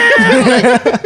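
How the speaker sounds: shrieked cat meow; loud meow. Retold or imitated. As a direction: yowl like a cat. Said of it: "my buddy just goes".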